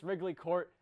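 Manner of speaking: ordinary delivery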